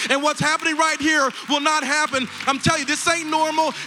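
high-pitched, urgent preaching; impassioned altar call. Real, not acted. Pastor urging new believers to walk to the front, impassioned preaching. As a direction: preach at full force, calling them forward.

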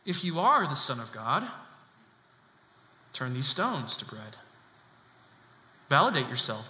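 low tempter's voice, pausing between each dare. Acted. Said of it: "what's the first temptation of the enemy?".

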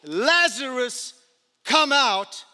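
loud, drawn-out call; shout. Acted. Jesus calling Lazarus from the tomb, a loud slow shout. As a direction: call him out of the grave. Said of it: "Jesus shouted".

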